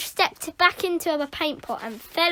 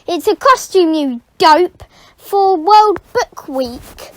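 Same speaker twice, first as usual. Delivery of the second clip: put-on scorn the silly boy; scornful; 3 semitones higher, 10 dB louder than usual. Caretaker to the boy, loud scornful put-down.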